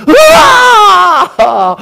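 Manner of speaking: loud, high drawn-out cry of alarm; mock scream. Preacher acts out the moment dominoes burst, mock scream. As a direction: let out a long anguished mock yell. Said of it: "터지는 하면서".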